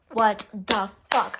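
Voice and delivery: slow, word-by-word disbelief; retold upset, slow and deliberate. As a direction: spread each word out slowly. Confronting her big while upset.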